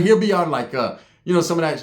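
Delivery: ordinary talk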